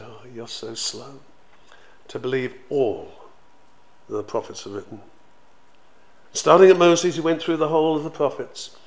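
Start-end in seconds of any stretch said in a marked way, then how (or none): 0.0-5.0 kindly rebuke. Retold or imitated. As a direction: chide them tenderly. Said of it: "the Lord was very kindly".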